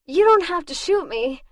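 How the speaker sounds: breathless plea to the gunman; gasped. Acted. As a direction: gasp it out while hanging on. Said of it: "I gasped".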